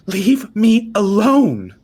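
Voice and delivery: high, loud, slow shriek. Acted. Frightened girl shrieking at the old woman, loud and high. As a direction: shriek it as you run past. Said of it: "the girl shrieks".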